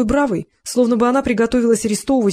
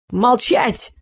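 короткий визгливый окрик; взвизгнуть на одном слове; visceral shriek; 3 semitones higher than usual. A narrator's reading. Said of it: "взвизгнул Эл". (визгливо, резко: «Молчать!»)

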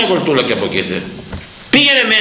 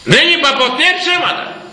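much higher, emphatic repeat; emphatic insistence; 11 semitones higher than usual. Real, not acted. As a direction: insist on it with raised pitch. Repeats the declaration far more forcefully. (έντονη διαβεβαίωση με υψωμένη φωνή)

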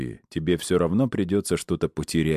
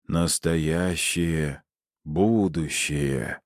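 загробный голос; much slower than usual. Acted. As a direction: chant each word like a seance medium. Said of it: "за могильным голосом". (медленно, загробным голосом медиума)

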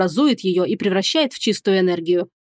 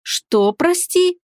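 baffled; 8 semitones higher, much slower than usual. Acted. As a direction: pitch leaps up in disbelief. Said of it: "не понял Вайрон".

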